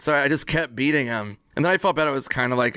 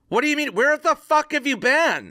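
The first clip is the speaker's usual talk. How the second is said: mock outraged demand; 13 semitones higher than usual. Confronting late friend at bar.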